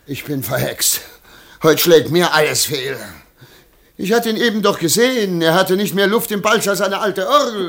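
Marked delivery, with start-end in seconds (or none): 1.6-7.7 loud, fast angry rant; venting his luck is cursed; frustrated outburst. Acted. Merchant to servant, ranting about his bad luck.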